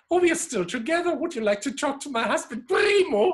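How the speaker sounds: high and loud, bubbling with joy; delighted old lady. Retold or imitated. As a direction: chirp it out happily, rising on Primo. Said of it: "And she said".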